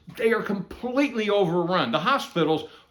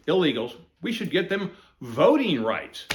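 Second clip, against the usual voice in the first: ordinary delivery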